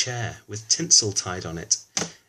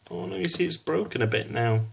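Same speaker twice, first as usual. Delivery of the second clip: ordinary delivery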